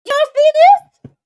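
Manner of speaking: high, loud call to the chat; excited call out. Real, not acted. Streamer calling the viewers to look, excited and loud.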